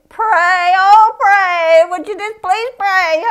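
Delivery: high, loud, whiny begging; mock the desperate pleas for prayer; pleading texters. Preacher mocking church members begging him, high whiny plea. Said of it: "people always eat, texting me".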